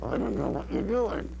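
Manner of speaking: high voice through closed lips; high puppet line, lips still; dummy voice. Shown as a demonstration. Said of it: "Use your voice through closed lips".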